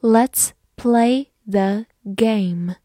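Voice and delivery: slow, separated words for learners; word-by-word model reading. Shown as a demonstration. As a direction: read slowly, one word at a time. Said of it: "慢慢来读".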